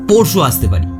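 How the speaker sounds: ordinary delivery, nothing marked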